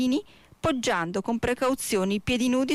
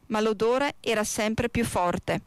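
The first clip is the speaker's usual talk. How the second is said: plain reading voice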